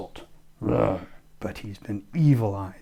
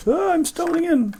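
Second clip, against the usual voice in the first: high hero voice; 6 dB louder than usual. Acted. Sigmund staggering in.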